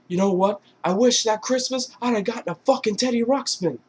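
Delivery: high-pitched indignant retort; mock outburst. Mark Wahlberg's character lashing out at Ted, mock outburst. Acted angry wish at Ted.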